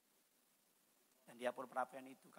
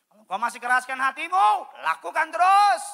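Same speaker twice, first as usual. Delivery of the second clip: high, loud, rising challenge; shouted warning; 24 dB louder than usual. Real, not acted. Pastor to those who harden their hearts, shouted warning. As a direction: dare them at full preaching volume. Warns the hard-hearted mid-sermon.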